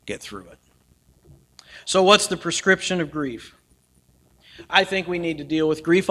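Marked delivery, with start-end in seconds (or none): 0.1-0.6 quiet. Real, not acted. Soft repetition of line 98.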